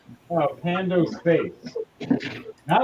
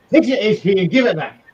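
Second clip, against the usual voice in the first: louder, higher chant; louder repeat; 4 semitones higher, 5 dB louder than usual. Acted. Healer character repeating her vow, louder and higher. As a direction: push the repeat harder.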